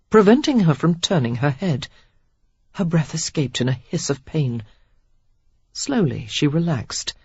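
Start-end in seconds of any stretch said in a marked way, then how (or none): none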